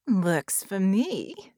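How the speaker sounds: very slow, drawn-out purr; purring villain. Acted. Baron menaces Red face to face, purring. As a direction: savor it like a predatory creature. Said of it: "Baron purred".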